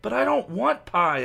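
a touch of mock wailing; whiny wail. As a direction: wail it like a sulking boy. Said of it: "Evan wailed".

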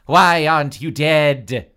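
loud, slow, anguished accusation; hammed-up, drawn-out shouting; crying out. A narrator's reading. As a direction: wail it at your victim in disbelief. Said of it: "Dina cried".

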